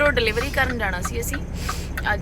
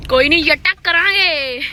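hearty shout; 7 semitones higher, 8 dB louder than usual. Real, not acted. Hearty reply to mechanic.

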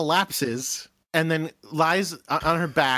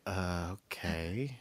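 unimpressed okay; 8 semitones lower, 12 dB quieter, slower than usual. Acted. Everybody reacts to the crazy offer.

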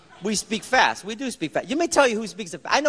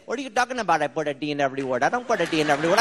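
put-on Indian-accented quick, indignant denial; mock-indignant Indian accent, hard d's; upset Indian accent; 5 semitones lower, faster than usual. Indian man denying it to a kid, indignant Indian accent. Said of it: "he got all upset".

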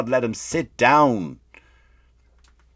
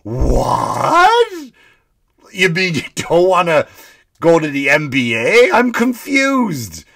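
far-off put-on voice, louder, climbing to a high slow "I'm confused"; mock Joe Biden; 4 semitones higher, 6 dB louder, slower than usual. Comic mocking Biden to his listeners, put-on baffled voice. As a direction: ham up a baffled old politician. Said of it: "And Joe goes".

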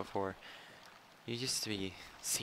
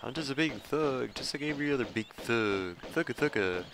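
raised, louder mock thug; 4 semitones higher, 4 dB louder than usual. Gamer mocking the enemy thugs, in a thug voice. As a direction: play a big thug, hammed up. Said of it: "the word thug just comes out in that voice".

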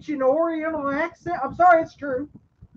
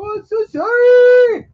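loud, high, hammed-up wailing sorry; mock crying apology; 7 semitones higher, 8 dB louder than usual. Mocking the wrestler after he hits the bell, a hammed-up wail. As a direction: fake sob it out, mocking him.

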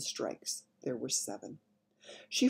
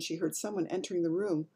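ordinary delivery

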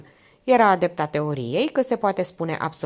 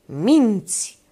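loud, raised accusation; sudden yell; 5 semitones higher, 6 dB louder than usual. A narrator's reading. Old man interrupts from the kitchen, yelling. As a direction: burst out yelling, higher and louder. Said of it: "urlă dintr-o dată un glas".

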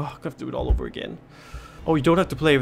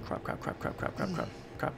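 rapid whispered cursing; panicked whisper; 12 dB quieter than usual. Real, not acted. Hiding from the angry man, frantic whisper. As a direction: whisper the panic under your breath.

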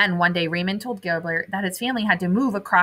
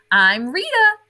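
put-on high, louder girlish hello; friendly intro; 8 semitones higher, 5 dB louder than usual. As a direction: greet a new friend brightly. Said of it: "replied the remora".

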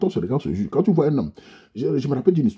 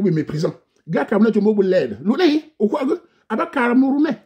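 much higher, excited street-slang voice; high mock Cameroonian slang; Cameroonian street talk; 11 semitones higher, 3 dB louder than usual. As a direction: ham up a Carrefour hustler.

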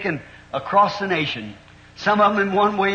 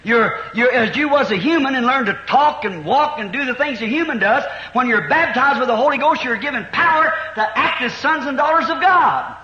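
raised, loud preacher's cadence, building; fervent preaching; 6 semitones higher, 4 dB louder than usual. Acted. Pastor urging the congregation about the Holy Ghost, fervent preaching. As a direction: preach it out with rising fire to the congregation.

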